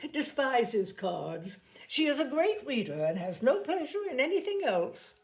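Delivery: a hint of a mocking society lady; sneering Miss Bingley. A narrator's reading. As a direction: belittle her as a bookworm. Miss Bingley belittling Elizabeth.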